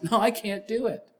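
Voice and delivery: high, strained refusal; voicing a worn-out lifter's complaint; inner protest. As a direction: whine that you can't go on. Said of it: "in our minds".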